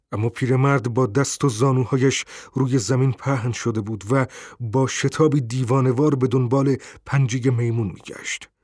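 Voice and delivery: plain reading voice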